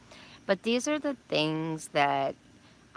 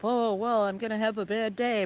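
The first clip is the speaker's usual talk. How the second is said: mock gloomy; 6 semitones higher than usual. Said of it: "you have to say".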